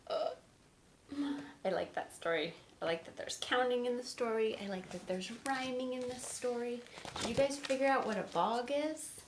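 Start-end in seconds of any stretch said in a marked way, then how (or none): none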